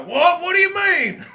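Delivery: high, loud startled protest; hammed-up shocked old dad; indignant old man. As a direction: sputter in disbelief. Dad told to take couch.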